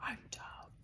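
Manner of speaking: barely voiced whisper to oneself; holding back tears. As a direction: whisper it very softly, willing yourself not to cry. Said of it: "I was holding it together".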